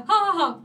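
loud, high "ha ha" laugh; demonstrated childhood laugh. Shown as a demonstration. Showing how she laughed as a child, loud demo laugh. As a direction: laugh it out loud, then snort inward. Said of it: "Jag kunde sitta så här".

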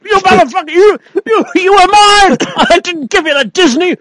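high-pitched mock rage, breaking into a laugh; mock furious rant. George Lucas whipping him, a hammed-up high furious rant.

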